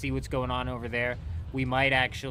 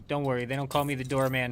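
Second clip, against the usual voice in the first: ordinary talk, nothing marked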